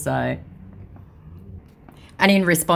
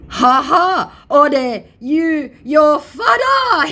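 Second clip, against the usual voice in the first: high, loud cackling taunt, slow at the end; mocking scammer voice; 12 semitones higher, 7 dB louder, much slower than usual. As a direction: jeer at him like a gloating scammer.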